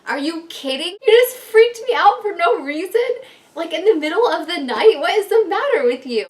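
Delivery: loud, high, indignant telling-off; retold outrage. Retold scolding of husband at night.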